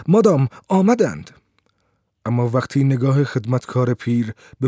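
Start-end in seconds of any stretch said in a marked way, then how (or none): 0.1-1.3 high-pitched calling out to madame; loud, hurried announcement. A narrator's reading. Maid rushing in to tell madame they came, calling loudly. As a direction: burst in and announce it, voice raised. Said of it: "با عجله وارد شد و با صدای بلند گفت".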